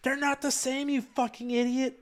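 high-pitched imitation of someone screaming; sustained high mock yell. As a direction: yell it as an outraged objector, voice pushed way up. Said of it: "someone screaming that's like".

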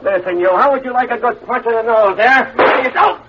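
snarling at a stranger to get out; tough threat. Acted. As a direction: run the pest off the phone. Threatening a stranger at phone booth.

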